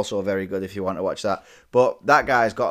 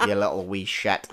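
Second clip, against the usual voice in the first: Northern Irish accent; 3 semitones lower than usual. Shown as a demonstration. Demonstrating how hard Northern Irish is, Northern Irish accent. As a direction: do a comic Northern Irish greeting. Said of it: "you know the Northern Irish accent".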